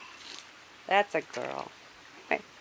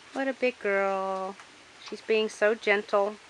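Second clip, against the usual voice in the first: ordinary talk, nothing marked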